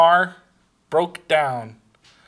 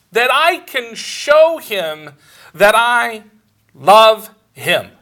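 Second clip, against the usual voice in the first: higher, louder, slow climax of the sermon; preaching at full force; 5 semitones higher, 5 dB louder than usual. Acted. Preacher telling the congregation how he loves God, fervently. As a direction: lift it into a fervent slow peak.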